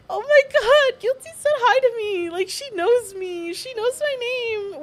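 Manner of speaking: high re-enacted happy wail, slowing down; mock-sobbing fangirl, starting loud; overwhelmed, tearful. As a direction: act out breaking down in starstruck joy. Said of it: "I literally broke down crying".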